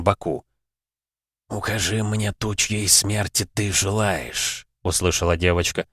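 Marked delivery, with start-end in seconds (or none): 1.5-4.7 soft, very slow rumbling grave voice; sepulchral voice. Acted. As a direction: speak from beyond the grave, slow and hushed. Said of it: "очень низкий, совсем не женский, ракочущий, что называется, замогильный голос". (замогильный, рокочущий, тихий и медленный голос)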